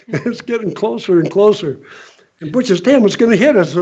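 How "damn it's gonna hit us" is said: louder, higher alarmed cry; mock panic. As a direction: act out a friend sure it'll crash. Butch panics at the helicopter.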